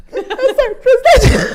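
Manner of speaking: very high, loud, wobbling voice; hammed-up crying to the president; mock teary plea. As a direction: squeak it out holding back tears. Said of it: "Eu fiz".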